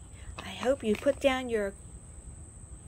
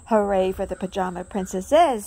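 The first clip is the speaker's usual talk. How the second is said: loud cheer with a falling end; 2 semitones lower, 7 dB louder than usual. A narrator's reading. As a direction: cheer everyone on. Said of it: "said Daisy".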